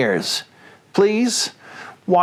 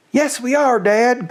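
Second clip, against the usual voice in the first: higher, eager cubs insisting; small foxes crying out to their father; 8 semitones higher than usual. Acted. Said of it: "cried the small foxes".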